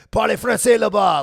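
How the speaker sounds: loud, high-pitched French yell; imitated yelling. French locals to the accented American, yelling. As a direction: bellow it like an irritated listener. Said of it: "They'd be yelling at me".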